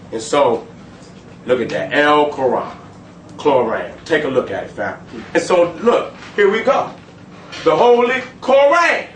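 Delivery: usual speaking voice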